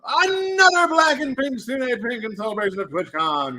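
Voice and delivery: usual speaking voice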